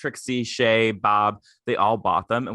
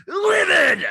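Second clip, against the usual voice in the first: high, loud burst on livid; livid shout; 7 dB louder than usual. Real, not acted. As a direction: belt out livid in outrage. Emphatic repeat of livid.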